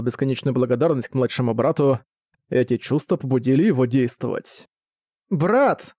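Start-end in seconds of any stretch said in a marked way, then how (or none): 5.3-5.9 put-on higher-pitched angry child's call. A narrator's reading. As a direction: call out crossly for a missing brother. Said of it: "сердито позвал Саски".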